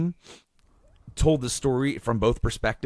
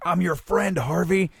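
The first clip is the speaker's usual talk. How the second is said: higher-pitched, earnest mock plea; appealing; 9 semitones higher than usual. Bruce trying to appeal to Harvey, pleading. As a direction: appeal to him as a friend, voice lifted. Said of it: "you could try to appeal to him".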